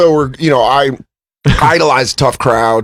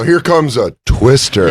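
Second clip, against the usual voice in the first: slow, lower, portentous film line; mock title drop; 4 semitones lower, slower than usual. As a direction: announce the film title hammily to camera. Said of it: "looked at the camera and they go".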